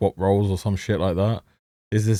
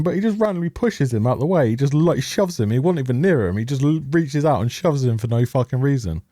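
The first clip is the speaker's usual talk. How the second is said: normal talking voice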